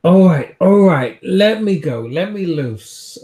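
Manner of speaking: loud, high-pitched giving in; exasperated plea. Acted. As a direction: beg loudly to be let loose. Captive character gives in, demands release.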